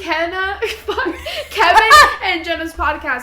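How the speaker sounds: laughing through a name mix-up. Real, not acted. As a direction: giggle as you fumble the names.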